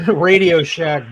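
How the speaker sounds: ordinary talk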